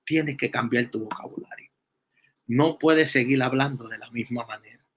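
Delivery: softly voiced God, quiet and low; a hint of a gentle divine voice. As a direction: speak as God, gently correcting her. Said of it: "Dios le dijo".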